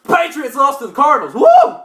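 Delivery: big high loud whoop of joy; excited cheer. Real, not acted. Fan cheering the Patriots losing, loud delighted whoop. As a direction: celebrate the loss out loud. Cheering a favorite team's loss.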